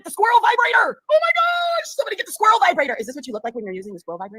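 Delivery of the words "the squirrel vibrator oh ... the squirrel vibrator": much higher, louder hammed-up squeal; mock shriek. Mocking the rival's excitement about the vibrator, loud squeal. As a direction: squeal it like a giddy fan.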